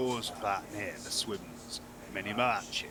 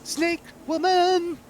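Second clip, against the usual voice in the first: very high, loud, slow exclamation; high drawn-out call; 12 dB louder, much slower than usual. Pretend. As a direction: stretch it out high and loud like a fanfare. Player dramatically announces the new figure.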